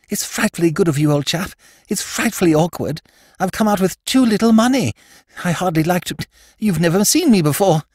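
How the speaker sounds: put-on high, eager young toff; lord Drever voice. Young lord approaching Jimmy's table, high eager toff voice. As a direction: pitch it up like a flustered young aristocrat.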